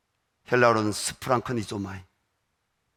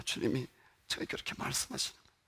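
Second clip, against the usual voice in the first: usual speaking voice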